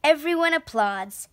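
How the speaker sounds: ordinary delivery, nothing marked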